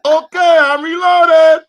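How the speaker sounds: high, loud mock-gangster yell; shouted movie quote. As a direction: belt it out high, stretching the last word. Mock movie line after the jab.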